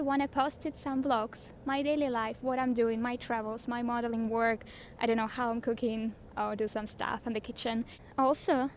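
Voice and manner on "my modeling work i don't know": ordinary talk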